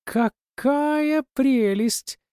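very high, slow ringing gush; ringing crystal chime voice. A narrator's reading. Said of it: "Звенящий голос".

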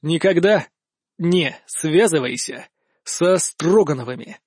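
slow, deliberate warning broken into pieces; measured "не связывайся со Строгановыми", split by pauses; word-by-word pauses. A narrator's reading. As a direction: leave a hard pause after each word, starting from "Никогда". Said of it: "выстрелы прерывали каждое моё слово, обозначая паузы".

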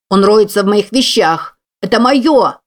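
yelling at full voice; loud outraged yell; shouting. Acted. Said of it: "вопит Кевин, что есть мочи".

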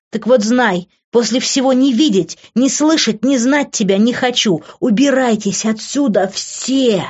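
high, heated accusations; angry tirade. Acted. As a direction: lash out at them all. Accusing tirade, fists clenched.

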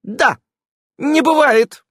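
high, loud insistent не бывает; loud warning не бывает. A narrator's reading. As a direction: raise the voice to shut him down. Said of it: "возвысила голос Ирен".

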